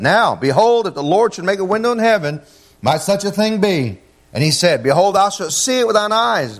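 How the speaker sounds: high-pitched, emphatic pulpit reading; scripture reading. Acted. Preacher reading Second Kings to his church, proclaimed scripture. Reads scripture aloud to congregation.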